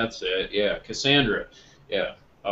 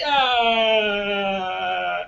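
high shrieking yell of rage; raging scream; 13 semitones higher, 8 dB louder than usual. Retold or imitated. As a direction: scream at the keyboard in fury. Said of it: "and he's like".

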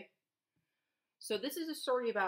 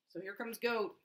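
ordinary delivery, nothing marked

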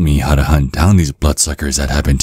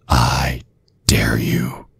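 sudden unvoiced whisper; 3 semitones lower, 5 dB quieter, much slower than usual. Villain whispering a dare to the priest. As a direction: drop to a menacing whisper.

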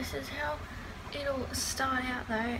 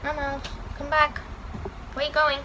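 raised pet-calling voice, climbing higher; calling a pet back; 4 semitones higher than usual. Real, not acted. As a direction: call after a wandering pet.

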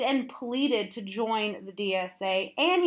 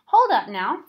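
loud, raised call to halt; 4 semitones higher, 8 dB louder than usual. A narrator's reading. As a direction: call out to stop someone at the door. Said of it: "Frypot called".

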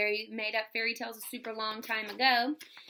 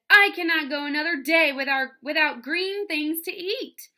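desperate wife; 6 semitones higher, 10 dB louder than usual. A narrator's reading. Wife to her husband about green things, desperate outburst. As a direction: exclaim it as someone at the end of her patience. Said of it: "went to her husband and said".

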